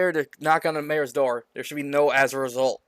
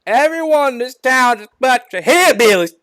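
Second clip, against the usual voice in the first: loud, high-pitched hillbilly mayor; hammed-up hillbilly voice; hillbilly accent; 8 semitones higher, 7 dB louder than usual. A narrator's reading. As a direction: holler it in a broad hillbilly accent. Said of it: "with his hillbilly accent".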